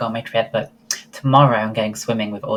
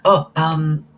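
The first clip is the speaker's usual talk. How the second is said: ordinary talk, nothing marked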